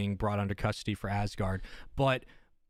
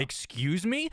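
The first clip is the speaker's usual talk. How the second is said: shocked question; 6 semitones higher, slower than usual. Retold or imitated. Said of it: "and you're like".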